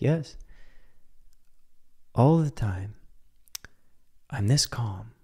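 a hint of unbothered calm; Deepak Chopra calm. Imitating a guest on a talk show, soft and serene. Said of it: "he was just so calm".

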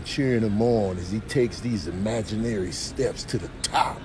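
low, quiet deadpan commentary; hushed play-by-play of someone doing the most; mock narrator. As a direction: narrate it softly, low in your range.

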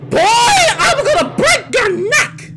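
high, loud, slow yell; startled shout. Acted. Startled by the stalker, shouting threats. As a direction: bellow it in outrage.

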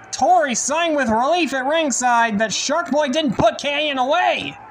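usual speaking voice

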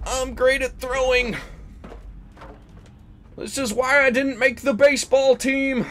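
high, loud self-mocking wail; hammed-up loud complaint; mock-frantic yelling. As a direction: cry out at your own bad throw. Yelling after a bad throw.

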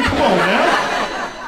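slow, loud drawn-out come on; exasperated. Pretend. Comic fed up with the fellas, slow loud plea. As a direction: stretch it out in mock exasperation.

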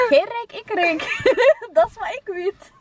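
high giggly teasing; laughing. Real, not acted. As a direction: laugh through the words. Giggling high after bragging.

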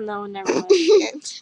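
ordinary talk

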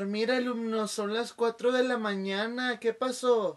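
groggy professor at four a.m; sleepy teacher. Acted. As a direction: answer the phone still groggy.

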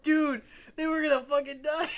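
loud, high-pitched mock panic; retold panic. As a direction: blurt it out like a panicked passenger. Retelling panic at calm friend.

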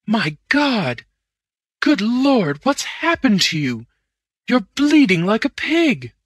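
high, loud horrified cries. A narrator's reading. Manager finding the bleeding man at the door, horrified. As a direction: gasp it out in horror. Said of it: "Ewbank's voice in horror".

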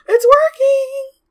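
real excited squeal; thrilled gasp. Real, not acted. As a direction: burst out delighted. Mod finally works.

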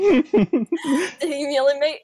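breaking into laughter mid-line; can't get through it for laughing. Acted. Voice actor cracking up mid-line.

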